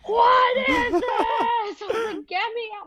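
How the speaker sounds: very high, hammed-up wail of disbelief; horrified outburst. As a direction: shriek it in mock horror, then plead. Acting out her horrified reaction.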